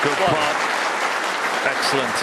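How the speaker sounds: loud, high burst of praise; excited praise. Real, not acted. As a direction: cheer the shot out loud. Reacts to a brilliant pot.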